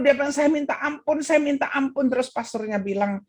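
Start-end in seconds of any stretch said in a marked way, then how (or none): 0.3-2.1 high-pitched, urgent retold begging; distraught pleading. Old man begs pastor for forgiveness, distraught. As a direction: plead it twice as if shaken.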